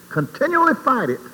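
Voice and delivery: high, loud preaching at full force. Acted. Preacher rousing the congregation to fight sin, loud and high. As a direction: drive it home to the pews.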